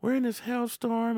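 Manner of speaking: high-pitched, fretful mock mother; hammed-up anxious mom voice; imitating nervous mom. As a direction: apologize in a high, flustered voice. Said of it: "nervous hound".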